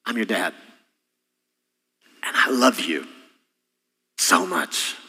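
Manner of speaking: soft, hushed fatherly reassurance; gentle quiet words to a son; tender dad. Retold or imitated. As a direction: speak it softly, like a father comforting his child. Father reassuring his child.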